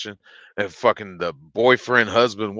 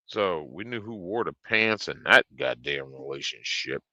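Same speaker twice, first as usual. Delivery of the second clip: ordinary talk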